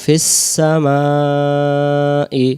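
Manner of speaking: ফিস সামাই with the s joined, very slow; joined fis-samai. Shown as a demonstration. Teacher joins the letters for students, very slow. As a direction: glide ফিস into সামাই, drawn out. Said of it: "সিন অক্ষরের সাথে ফা অক্ষর মিলবে".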